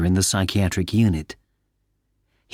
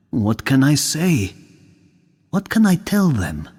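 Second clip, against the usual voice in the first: lost man's thoughts. Acted. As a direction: think it to yourself, hopeless. Man's inner monologue.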